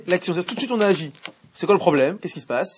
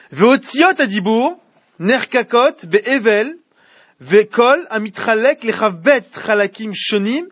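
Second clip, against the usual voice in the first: slow, louder chanted reading of the Hebrew; text recital; 4 semitones higher, 3 dB louder, slower than usual. Acted. Teacher to class, reading the Hebrew source in a slow chant. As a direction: intone the source text like a study-hall reading.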